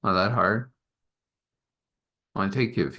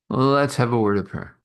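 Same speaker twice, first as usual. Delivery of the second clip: plain reading voice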